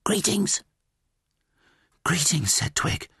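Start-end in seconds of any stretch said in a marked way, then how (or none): 0.0-0.6 high, hushed bird voice; whispering caterbird. Acted. Caged caterbird greeting Twig, high whisper. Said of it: "the caterbird whispered". 2.0-2.5 lightly raised pitch, voiced reply; young hero's voice. Twig answering the caterbird, lightly voiced greeting.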